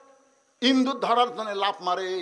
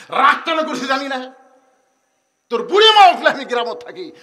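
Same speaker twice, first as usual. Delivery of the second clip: angry father; 8 semitones higher, 13 dB louder than usual. Acted. Father's angry retort at door.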